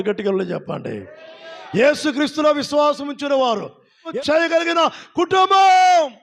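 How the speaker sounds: full force preaching. Acted. Pastor stirring the congregation about Martha and Mary, building shout. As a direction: hammer it home to the crowd.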